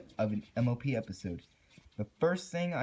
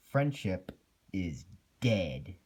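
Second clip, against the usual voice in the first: ominous title; 5 semitones lower, slower than usual. Acted.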